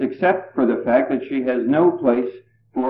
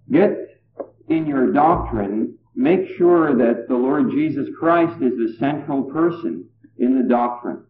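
ordinary talk, nothing marked

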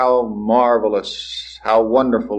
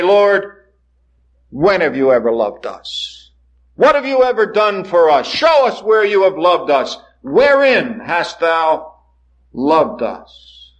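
higher, slow, indignant mocking demand; defiant challenge; 7 semitones higher, slower than usual. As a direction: shake a fist at heaven with your voice. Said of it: "shake their fist into His face".

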